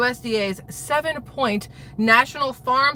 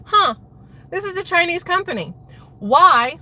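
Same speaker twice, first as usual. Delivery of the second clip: high, loud puzzled Huh; retelling the moment she spotted the Chinese label; retold surprise; 8 semitones higher, 5 dB louder than usual. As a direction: discover it with sudden alarm. Retold discovery of Chinese company.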